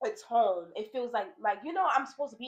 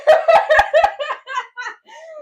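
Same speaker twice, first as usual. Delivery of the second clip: big high burst of real laughter; loud laughter; 17 dB louder than usual. Real, not acted. Laughing hard at her own confession to her friend.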